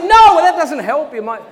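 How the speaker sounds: loud, high panicky blurt; flustered outburst. Retold or imitated. Young him answering his friend Gareth, loud flustered blurt. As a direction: fluster and backpedal loudly.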